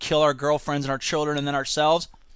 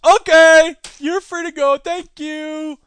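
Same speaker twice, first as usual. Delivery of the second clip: much higher, louder bribed official; mock cheerful official; 9 semitones higher, 7 dB louder, slower than usual. Legislator accepting the bribe, mock cheerful. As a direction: chirp it like a sellout.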